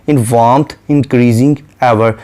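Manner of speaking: very slow, measured poetic reading; a lightly recited line of poetry; slow poem recitation. Shown as a demonstration. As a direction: draw out each word of the verse.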